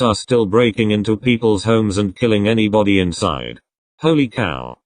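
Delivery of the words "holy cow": ordinary talk, nothing marked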